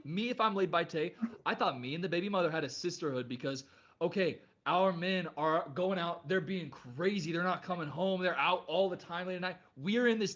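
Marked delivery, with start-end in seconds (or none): none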